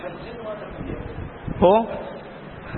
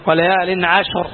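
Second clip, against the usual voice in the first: normal talking voice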